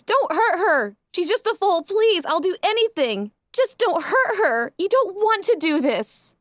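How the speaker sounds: high, pleading cry for the filly; desperate scream. A narrator's reading. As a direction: beg them to spare the foal, voice pitched high. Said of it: "Ditzy screams".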